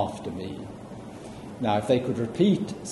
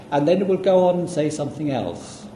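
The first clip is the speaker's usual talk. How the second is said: ordinary talk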